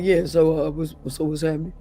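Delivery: mock changed voice. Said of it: "now you be like".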